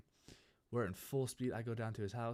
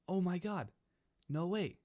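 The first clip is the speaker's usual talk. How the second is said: high, louder mock amazement; retold astonishment, pitched way up; amazed reaction; 9 semitones higher, 5 dB louder, slower than usual. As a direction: act out a kid's wowed disbelief. His amazed reaction to the news.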